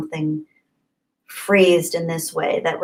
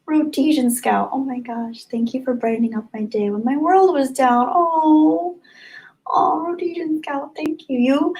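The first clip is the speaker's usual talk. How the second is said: moved, near tears; 7 semitones higher than usual. Real, not acted. Moved thanks to a viewer.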